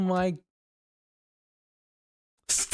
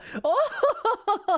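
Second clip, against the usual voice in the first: high haughty noble-lady laugh; ojou-sama laugh. Pretend. Haughty ojou-sama laugh aimed at the viewers. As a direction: cackle like a smug anime villainess. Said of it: "that laugh".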